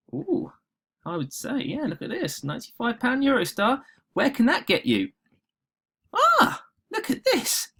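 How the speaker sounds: thrilled at a travel bargain; excited. Real, not acted. Spots cheap Eurostar trip, thrilled.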